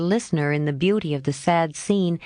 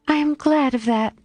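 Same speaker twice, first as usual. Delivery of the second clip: exclaiming; 9 semitones higher than usual. A narrator's reading. Meg hearing the tutor will be a soldier, higher eager exclamation. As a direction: burst out gladly. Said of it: "exclaimed Meg".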